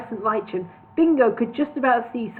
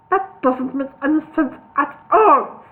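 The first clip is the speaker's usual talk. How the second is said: slow, louder indignant whistle; steam whistled; 4 semitones higher, 5 dB louder than usual. A narrator's reading. Solomon fuming at Bingo, slow. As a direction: fume through the words. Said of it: "steam whistled Solomon".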